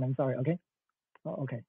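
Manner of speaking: usual speaking voice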